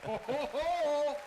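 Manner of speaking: slow, loud, high ho-ho-ho; Santa belly laugh. Pretend. Santa arriving at the show, drawn-out ho-ho-ho. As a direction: draw out each Santa ho.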